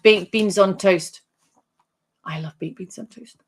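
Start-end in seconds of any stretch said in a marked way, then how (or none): 2.2-3.4 a near-silent murmur, lower and much softer; hushed spirit voice. Pretend. Medium softly voicing the spirit for Mary, hushed. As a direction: voice the spirit's line low and hushed, almost a whisper.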